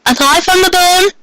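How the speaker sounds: put-on high, bright young girl voice, bewildered; Molly's girlish voice. Confused girl questioning the strangers around her, high voice. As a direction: pitch way up as a startled girl.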